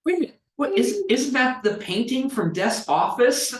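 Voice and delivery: very high, incredulous mock outburst; astonished recognition. Game master voicing players recognizing the painting, astonished. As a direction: squeak out the dawning realization.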